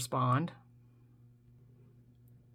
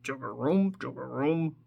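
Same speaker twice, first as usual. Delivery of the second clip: a hint of a croaking bullfrog; bullfrog call; 3 semitones lower, 3 dB quieter than usual. Telling viewers what bullfrogs say, lightly croaked call. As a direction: mimic a bullfrog's deep call. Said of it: "Some people say it sounds like".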